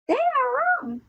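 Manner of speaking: very high reindeer squeal; distressed cry. A narrator's reading. Chopper accusing everyone else, high distressed cry. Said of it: "he cried".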